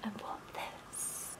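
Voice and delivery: slow hushed whisper of wanting; whispered longing. Real, not acted. Vlogger whispering to camera over a parcel, longing. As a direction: breathe it like a secret wish.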